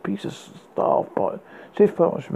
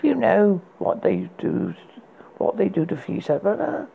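cool; 6 semitones higher than usual. A narrator's reading. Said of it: "she coolly said".

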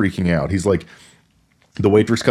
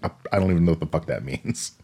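low, soft, quick brush-off; deadpan retort; 5 semitones lower, 8 dB quieter, faster than usual. Retold or imitated. Tasha Yar shutting down the creep, deadpan. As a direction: flatly dismiss him.

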